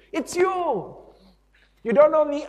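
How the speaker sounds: high, loud squeal of recognition; excited recognition. Acted. Stranger greeting someone in heaven, excited high shout. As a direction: pitch it way up, thrilled to spot someone.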